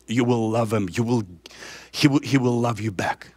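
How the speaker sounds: low, soft, intimate sermon voice; quiet tender preacher; tender preaching. Acted. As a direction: draw them close with a hushed promise.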